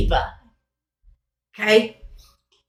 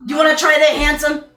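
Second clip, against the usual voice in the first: flirty offer; 6 semitones higher, 4 dB louder than usual. Acted. Jack flirts with player.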